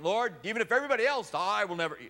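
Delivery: high, fast, boastful vow; arrogant. Acted. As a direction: swear it with brash overconfidence. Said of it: "Instead of being that arrogant".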